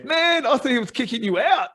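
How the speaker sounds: high-pitched, incredulous exclamation; mock astonishment. Canadian guy to his training partner, mock astonishment.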